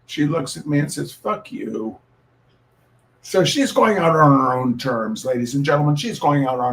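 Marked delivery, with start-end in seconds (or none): 1.1-2.0 a breathy, half-whispered cat voice, a little higher; cat's rude reply. Pretend. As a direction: voice the cat sneering it under her breath. Cat answers owner in voiced quote.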